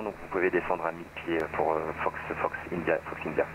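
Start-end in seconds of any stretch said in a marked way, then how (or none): none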